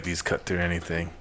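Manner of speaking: action line. Acted. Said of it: "You know, action line".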